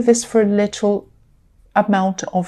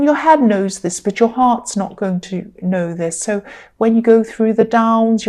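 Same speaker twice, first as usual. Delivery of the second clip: usual speaking voice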